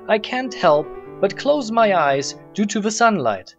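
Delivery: ordinary talk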